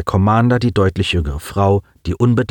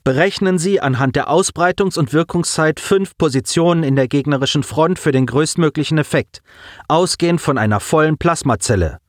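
a lightly voiced, higher officer giving orders; Lieutenant Guges; 7 semitones higher than usual. A narrator's reading. Lieutenant briefing her tactician, lightly voiced higher command tone. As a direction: give the order crisply as the cool lieutenant. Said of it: "sagte Lieutenant Guges".

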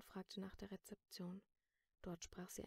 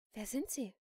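puzzled question, slightly raised; confused; 3 semitones higher, 7 dB louder than usual. A narrator's reading. Shopkeeper has lost her memory, confused question. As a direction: ask it in bewilderment. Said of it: "fragte die Verkäuferin verwirrt".

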